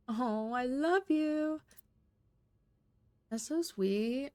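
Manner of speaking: long lovestruck "aww" delivery; touched gushing. Real, not acted. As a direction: melt over something sweet. Player gushes over love letter.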